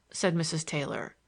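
ordinary delivery, nothing marked

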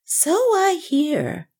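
put-on high, slow, eager old voice; eager cry. A narrator's reading. Banker eagerly agrees with Northmour, high and drawn out. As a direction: jump at the praise, eagerly. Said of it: "cried Mister Huddlestone eagerly".

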